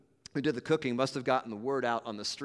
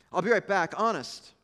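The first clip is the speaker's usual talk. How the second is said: eager promise; 6 semitones higher, 5 dB louder than usual. Acted. Agnes eager, pleading.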